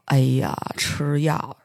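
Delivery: slow, low, soft and hesitant; a doctor stalling on an answer; dragged-out doubtful 哎呀; reluctant sigh. Retold or imitated. Doctor hesitating in retold scene.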